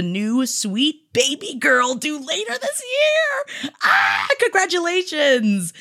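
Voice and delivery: high, drawn-out gushing squeal; squealing delight. Acted. Host cheers a new baby girl, squealing delight. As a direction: let it climb into a delighted shriek.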